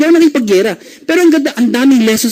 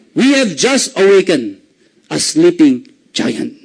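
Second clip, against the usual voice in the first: slow, measured, sinking lower at the end; weighty quote from a wartime leader; solemn and drawn-out pronouncement; grave Japanese Emperor; 5 semitones lower, slower than usual. Acted. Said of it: "Ang sabi ng Emperor".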